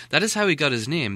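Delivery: ordinary delivery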